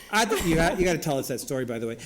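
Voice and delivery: ordinary talk, nothing marked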